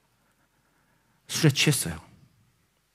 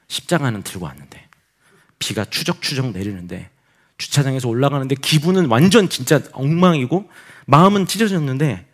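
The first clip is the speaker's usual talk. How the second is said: ordinary delivery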